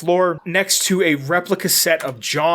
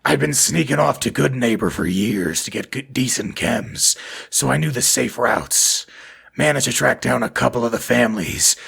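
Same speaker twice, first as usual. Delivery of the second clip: ghoul voice; 4 semitones lower than usual. Shown as a demonstration. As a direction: growl it out like a ghoul. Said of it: "should I read this in a ghoul voice?".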